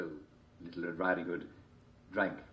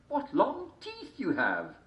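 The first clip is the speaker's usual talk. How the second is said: put-on slow girl voice; much slower than usual. A narrator's reading.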